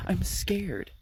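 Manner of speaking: scared whisper. Retold or imitated. Jessica approaching car, frightened.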